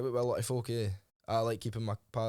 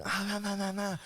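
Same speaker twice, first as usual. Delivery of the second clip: higher, hammed-up angry broken English; mock angry Thai accent; 8 semitones higher than usual. Retelling the lady boy's furious threats, in a mock Thai accent. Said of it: "she was getting it all".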